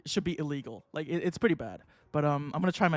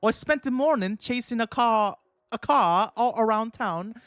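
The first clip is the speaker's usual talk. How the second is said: hammed-up British lecturer, slightly raised; British professor voice; 4 semitones higher, 4 dB louder than usual. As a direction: put on a bad British accent addressing students. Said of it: "British voice, and my British accent".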